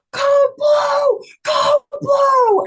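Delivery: very high, loud shouted alarm. Retold or imitated. Staff calling a code as she collapses, loud shout. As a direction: call the emergency urgently. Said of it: "And then I heard".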